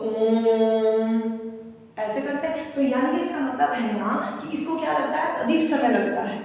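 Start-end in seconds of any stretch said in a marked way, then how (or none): 0.1-1.2 ओम chanted, the vowel held very long and slow; prolonged chanted ओम; drawn-out Om. Shown as a demonstration. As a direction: sing out ओम, stretching the o. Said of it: "अगर मैं उच्चारित करूँ यहाँ ओम को".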